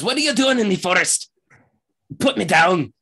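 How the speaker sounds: high, loud protesting character voice; indignant halfling. Acted. Halfling held by his shirt, indignantly protesting. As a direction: squawk in outrage at being held.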